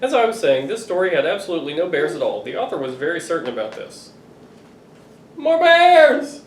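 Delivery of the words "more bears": put-on high, loud chant of a child; children shouting. Dad voicing the shouting kids, high and loud. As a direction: shout it like an excited kid. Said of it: "voices of children shouting".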